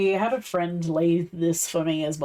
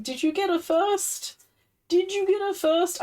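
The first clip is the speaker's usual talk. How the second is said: excited; 10 semitones higher than usual. Real, not acted. Excited question to chatter.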